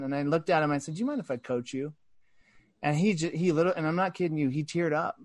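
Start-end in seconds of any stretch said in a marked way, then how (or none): 1.0-1.9 quiet, tender question to a nervous employee; a gentle, quiet offer to coach; soft gentle ask. Retold or imitated. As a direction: ask it softly, like an arm around him.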